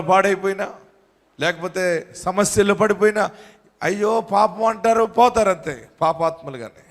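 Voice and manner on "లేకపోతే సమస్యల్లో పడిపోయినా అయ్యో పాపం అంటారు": ordinary talk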